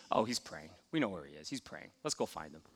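lightly voiced, soft disciples answering; hushed disciples. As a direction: drop low and hushed like a murmured aside. Disciples voiced quietly in story.

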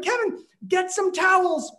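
high, urgent shout at her husband; mom shouting. Retold or imitated. Mom orders husband to fetch towels, high urgent shout. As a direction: call across the house to get towels fast. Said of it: "she shouted".

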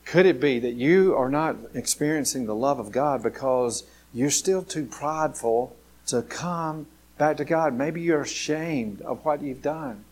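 ordinary talk

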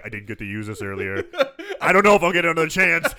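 fast mock line building to a shout; hammed-up Christopher Lee. Christopher Lee eager to finally use the grenade. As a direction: rattle it off gleefully, then shout the end. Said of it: "Chris Rose like".

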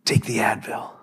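softly whispered suggestion; whispered advice. Retold or imitated. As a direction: murmur it like a wife's gentle nudge. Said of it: "Jendy's like".

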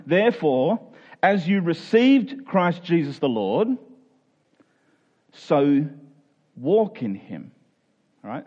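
ordinary delivery

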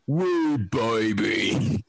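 low, very slow drawn-out taunt; mock British thug. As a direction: stretch out each word in a hammed-up British accent. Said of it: "He's like".

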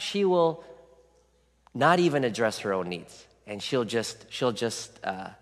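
normal talking voice

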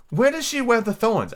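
high, slow wondering question; curious. A narrator's reading. Alice wondering about the thorny flower, high curious tone. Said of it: "asked with some curiosity".

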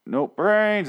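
a lightly high dopey zombie; mock zombie voice. Retelling the Simpsons zombies, lightly high mock moan. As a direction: say it like a cartoon zombie turning away. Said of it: "the Simpsons episode where they're like".

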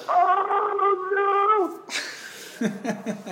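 high squeaky oh no; mock horrified cry. Retelling panic as skin hardens.

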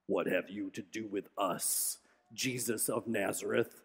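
very low, quiet, ghostly growl of a demon; scary demon voice. Acted. Preacher voicing a demon to the congregation, in a deep scary rasp. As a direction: rasp it out like something from a horror movie. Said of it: "I want you to imagine this really scary, awful voice".

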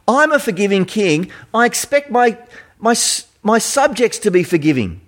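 high, earnest royal rebuke; a forgiving king scolding his servant; disappointed king. Retold or imitated. King reproaching the unforgiving servant, high and earnest. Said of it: "the king says to him".

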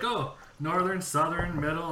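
mock ditzy blonde asking where he's from; imitating a bubbly girl. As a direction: chirp it like a ditzy stranger on a bus. Blonde chick quizzing him.

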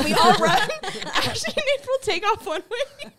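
high-pitched telling through real laughter; laughing. Real, not acted. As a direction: barely get the story out for laughing. Cracking up telling the dog story.

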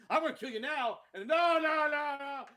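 high, loud hammed-up shout; mock movie threat. Mocking the movie's endless fake threats, loud shout. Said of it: "they keep pretending to shoot".

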